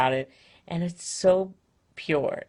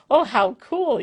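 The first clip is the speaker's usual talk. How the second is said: put-on higher, louder toddler delight; excited little girl; 9 semitones higher, 7 dB louder, slower than usual. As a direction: play the three-year-old thrilled by it. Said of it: "sounded like she was all excited".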